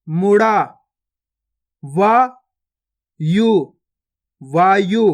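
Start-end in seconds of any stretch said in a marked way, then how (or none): 1.8-3.5 गुफा drawn out very slowly, each sound held long; slow stretched reading. Shown as a demonstration. Teacher showing pupils each sound, drawn out very slowly. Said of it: "पहले तो आपको शब्द को गौर से सुनना है".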